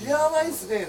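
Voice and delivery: 高い声で興奮して; 大げさに驚いてみせる; 驚いた口調. Retold or imitated. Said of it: "だって". (高めの驚き声)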